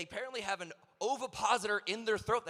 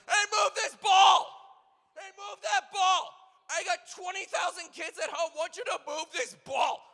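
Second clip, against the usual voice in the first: high, loud, drawn-out mock yelling; yelling YouTuber; 10 semitones higher, 10 dB louder than usual. YouTuber with GoPro taunting the ghost, high loud hammed-up yelling. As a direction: holler at the ghost like an obnoxious ghost hunter.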